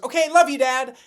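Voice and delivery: lightly voiced kid calling out love you; a son tossing a quick goodbye to his dad; kid's cheerful goodbye. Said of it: "And he goes".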